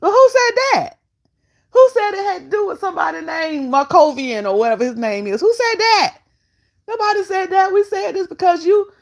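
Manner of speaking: high, incredulous demand for who said it; indignant protest. Real, not acted. Host challenging the guest's claim, indignant and high. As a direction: push back hard, pitch up in disbelief.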